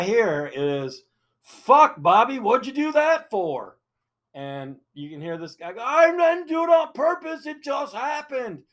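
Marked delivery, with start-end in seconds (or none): 1.4-3.8 high, loud yell of an irate buddy; angry yell. Retold or imitated. Camper overhears a man berating his crashed driver, angry yell. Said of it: "they're arguing and yelling, screaming". 5.8-8.6 very high, strained imitation of a yelling driver; defensive yell. Crashed driver protests to his angry buddy, defensive yell. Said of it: "they're arguing and yelling, screaming".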